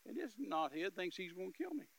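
normal talking voice

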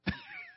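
high burst of laughter. Real, not acted. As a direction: let a high laugh slip out. Preacher laughs after his joke.

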